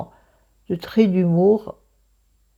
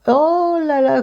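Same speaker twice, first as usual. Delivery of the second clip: surprised; 11 semitones higher, 5 dB louder, slower than usual. Real, not acted. Exclaiming at the noise.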